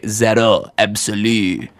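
Zero Absolu in a self-mocking French accent. Shown as a demonstration. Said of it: "with my awesome French accent".

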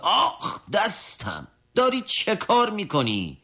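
sharp high yelp of pain, then a complaint; pained cry. A narrator's reading. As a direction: cry out as the needle bites. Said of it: "پهلوان فریاد زد".

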